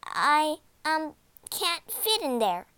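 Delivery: put-on high, halting child's voice; hesitant girl. A narrator's reading. Gretel feigns helplessness to the witch.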